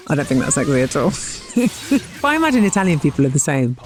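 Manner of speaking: normal talking voice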